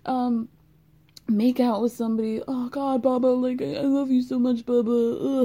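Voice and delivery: high, fast, gushing apology voice; sheepish Tom impression. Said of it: "come to her in a dark corner ... and say".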